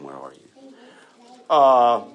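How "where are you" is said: "Where are you?" dropped much softer and lower; soft spiritual question. Shown as a demonstration. Pastor voicing God's searching question, hushed. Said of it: "This is a spiritual question".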